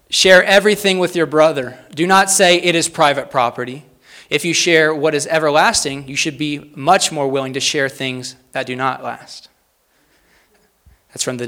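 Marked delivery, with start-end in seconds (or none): 0.1-9.5 strong opening, settling into a slow close; deliberate quote reading. Real, not acted. Preacher reads from the Didache to the congregation, firm and deliberate. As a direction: read it out firmly, then slow down. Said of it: "read these slowly and succinctly".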